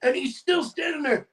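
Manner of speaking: normal talking voice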